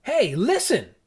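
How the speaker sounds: high-pitched urgent call for attention; acted worried insistence; insistent exclamation. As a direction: insist that they pay attention. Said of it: "insisting that she's noticing something of consequence".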